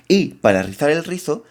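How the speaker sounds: ordinary talk, nothing marked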